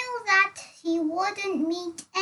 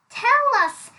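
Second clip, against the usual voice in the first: high, louder mock demand; teasing elephant; 7 semitones higher, 6 dB louder than usual. A narrator's reading. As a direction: tease him with the question. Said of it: "said the clever elephant".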